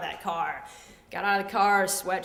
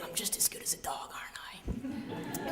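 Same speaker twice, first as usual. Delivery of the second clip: small, whispered pet voice; whispered plea; 9 dB quieter than usual. Retold or imitated. Lizard looking up at the kids, whispered plea. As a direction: whisper it as a hopeful question.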